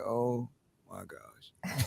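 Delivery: soft, slow, stunned "oh my gosh"; stunned hush. Retold or imitated. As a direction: mutter it in quiet disbelief. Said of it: "Them dudes were just sitting there like".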